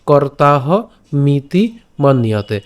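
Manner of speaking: কর্তাহ মিতি মন্যতে very slowly; slow pronunciation. Shown as a demonstration. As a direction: drag each syllable. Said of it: "verse drill".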